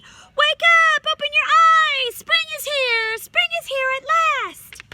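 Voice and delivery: very high, very loud joyful shout. A narrator's reading. Children shouting to wake their parents, very high and very loud. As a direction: yell it at the parents' bed. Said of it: "and shouted".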